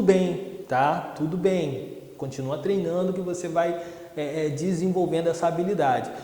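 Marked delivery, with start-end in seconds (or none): none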